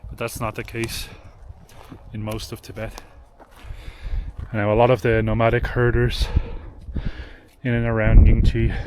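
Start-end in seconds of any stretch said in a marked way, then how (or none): 4.5-8.7 out of breath, pushing words out; winded from thin mountain air; breathless at high altitude. Real, not acted. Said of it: "sorry if I'm out of breath".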